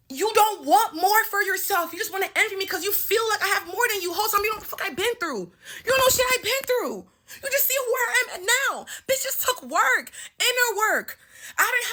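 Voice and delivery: rising, heated call-out; heated rant. Real, not acted. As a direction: let the anger climb as you go. Ranting at the former friend.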